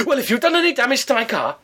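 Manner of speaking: high indignant mock voice of an older man; pompous neighbour. Neighbour lectures her at the door, high and pompous. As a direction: puff up like an officious ex-copper.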